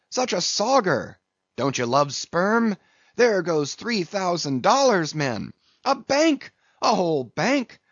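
high-pitched, pleading bursts of exclamation; frantic excited crying. A narrator's reading. Flask begging his lads to catch the whale, excited cry. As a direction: beg and cheer them on, hopping with excitement. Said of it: "cried Flask, dancing up and down".

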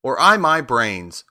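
ordinary delivery, nothing marked

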